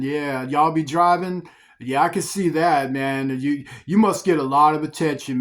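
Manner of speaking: butter them up like a slick guy eyeing your stuff; flattering neighbor who wants what you got; coveting smooth-talker. Retold or imitated. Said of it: "you see that guy talking for whom I'm emulating".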